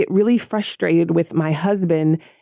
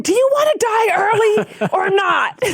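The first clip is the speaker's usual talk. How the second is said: much higher, crying-out mock fury; exasperated shriek; 12 semitones higher than usual. Wife confronting husband over hidden snack wrappers, high exasperated cry. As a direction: scold him like a fed-up wife.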